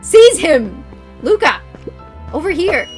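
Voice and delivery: loud commanding shout; shouting orders. Acted. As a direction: bark the order across the room. Villain orders guards, player calls.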